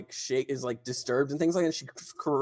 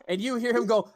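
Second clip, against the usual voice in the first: normal talking voice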